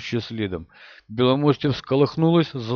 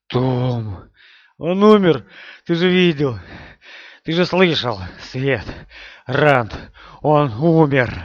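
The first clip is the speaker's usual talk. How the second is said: distraught; 6 dB louder, slower than usual. Acted. Matt despairing over Tom's death.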